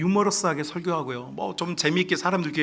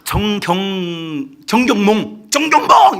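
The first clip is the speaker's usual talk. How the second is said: name drawn out, building to shout; imitated demon calling; 6 semitones higher, 11 dB louder, much slower than usual. Demon calls out a churchgoer's name, building to a mock shout. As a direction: start slow and level, end high and loud. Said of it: "남자 목소리로 하는 말이".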